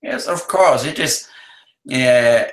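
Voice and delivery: usual speaking voice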